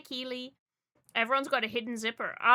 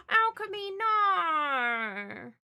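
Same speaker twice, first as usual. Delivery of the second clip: gasp of dismay; 6 semitones higher, 4 dB louder, much slower than usual. Real, not acted. Reacts to bad news.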